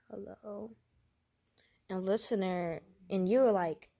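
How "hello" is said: softly voiced sick character; a quiet, feeble phone greeting; Robin. Sick character picking up the phone, soft weak voice. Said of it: "this is Robin talking right now".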